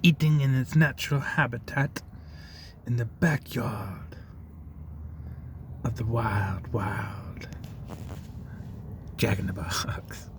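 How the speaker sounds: hushed, slow wildlife narrator whisper; nature documentary. Acted.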